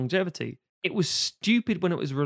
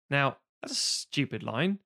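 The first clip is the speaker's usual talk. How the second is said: ordinary delivery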